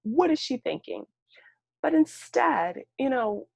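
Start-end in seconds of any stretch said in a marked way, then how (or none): 0.0-1.1 high, incredulous put-on voice of blame; judgmental inner voice. A staffer judging the late mother, high and incredulous. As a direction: scoff at her like an exasperated staffer. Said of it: "you might ask".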